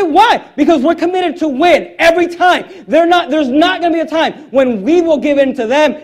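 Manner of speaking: emphatic preaching. Real, not acted. Preacher vows parents will never give in, emphatic. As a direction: preach it with forceful resolve. Preacher declares resolve to win.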